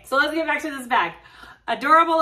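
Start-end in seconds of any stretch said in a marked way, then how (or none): 1.7-2.2 higher, louder gush of delight; real burst of delight; excited gush. Real, not acted. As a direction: squeal it out over the bag. Gushing about the bag.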